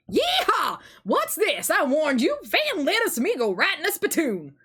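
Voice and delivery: whooping cowboy voice gone Southern; Western accent. Acted. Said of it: "trying to do a Western accent".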